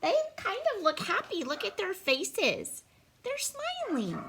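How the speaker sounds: high, light storybook delight. Pretend. Reader shows child happy faces, delighted. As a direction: coo happily in a high voice.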